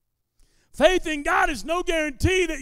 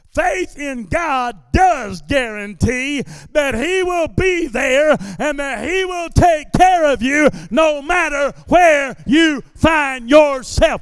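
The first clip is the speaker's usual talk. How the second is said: preaching at full force; 3 semitones higher, 6 dB louder than usual. Acted. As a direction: drive the promise home to the congregation. Climax of sermon.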